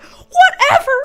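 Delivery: loud, very high squeal; exasperated dismissal. Retold or imitated. Wife giving up the argument with husband, exasperated. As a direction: throw it away in a high mock-huffy yell.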